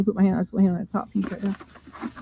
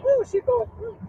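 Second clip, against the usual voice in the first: still tearful; 10 semitones higher than usual. Real, not acted. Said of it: "I always cry".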